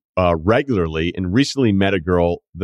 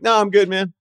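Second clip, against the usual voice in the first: breezy brush-off; 9 semitones higher than usual. Retold or imitated. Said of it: "Be like".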